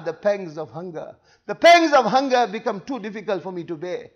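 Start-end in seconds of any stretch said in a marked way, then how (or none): 0.0-1.2 low, soft and weary; pained, hushed reply. Acted. The Prophet answering Aisha about hunger, hushed. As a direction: let the confession sink quietly.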